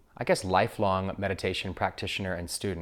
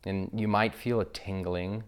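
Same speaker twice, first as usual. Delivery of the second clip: guided meditation. Acted. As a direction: guide listeners gently into breath awareness, unhurried.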